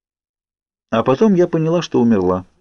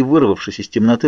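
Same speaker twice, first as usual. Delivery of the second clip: plain reading voice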